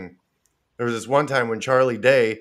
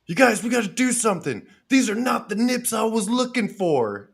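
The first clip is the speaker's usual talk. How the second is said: a little higher, mock alarmed bar owner; urgent Frank; 8 semitones higher than usual. Frank rallying the bar gang, mock urgent. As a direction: call the gang to action, half-hammed. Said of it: "Frank goes".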